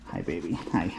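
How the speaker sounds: low, soft coo; tender pet greeting. Real, not acted. Owner greeting the dog up close, low soft pet talk.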